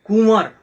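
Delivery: कुंवर said loud and clear with a falling end; careful pronunciation. Shown as a demonstration. Teacher modelling कुंवर for pupils, loud careful pronunciation. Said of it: "इन शब्दों को शुद्ध उच्चारण कीजिए".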